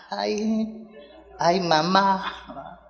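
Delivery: a soft, drawn-out mock lament; hint of a whiny society wife; mocking the ambassador's wife. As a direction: sigh it like a fussy lady. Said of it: "igual que la esposa del embajador".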